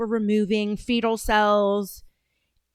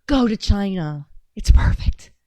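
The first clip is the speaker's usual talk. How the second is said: whispered sarcastic aside; 3 semitones lower, 4 dB louder than usual. Real, not acted. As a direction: whisper it like a secret tip.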